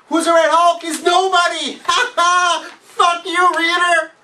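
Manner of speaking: high, loud jeering with a laugh; mocking outrage. Mocking the comic's reveal to readers, loud jeering laugh. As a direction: jeer at the comic as if it laughs at you. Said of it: "And you tell me".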